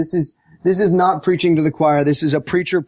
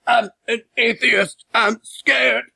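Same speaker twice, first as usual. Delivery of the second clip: mock scared dying man; 5 semitones higher, slower than usual. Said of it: "he's like".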